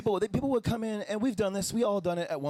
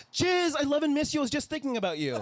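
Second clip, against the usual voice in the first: high, gushing text message; high gushing text read to an old friend; mock sweet text; 10 semitones higher than usual.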